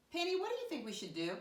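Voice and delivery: high-pitched, quick question to Penny; coaxing question. Pretend. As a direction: ask Penny in a high, sing-song voice. Teacher turns to ask Penny.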